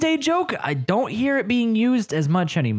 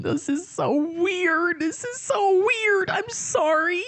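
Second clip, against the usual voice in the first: higher, slow, giggling through the words; high embarrassed giggle; 13 semitones higher, slower than usual. Real, not acted. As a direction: laugh off the embarrassment in a squeaky voice. Caught singing, embarrassed.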